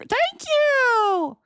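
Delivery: high delighted thanks; delighted squeal. Real, not acted. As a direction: squeal it out happily. Reacting to compliment.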